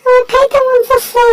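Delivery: high cartoon animal voice, whiny and hungry; high, fussy critter voice; squeaky pet voice. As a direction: speak as the greedy little pet begging for food.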